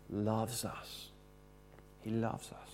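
softly assuring the congregation that he loves them; tender hush. Real, not acted. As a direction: let it fall to a soft tender hush. Tender climax about Jesus' love.

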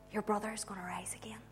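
hushed secret shared privately; very soft, intimate reveal; confiding whisper. Acted. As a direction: whisper it to one trusted person. Jesus confiding a secret to Martha.

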